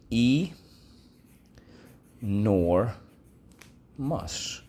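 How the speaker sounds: Enormous split as E, nor, mus with pauses; syllable by syllable. Shown as a demonstration. Teacher breaking Enormous into syllables. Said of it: "So let's go".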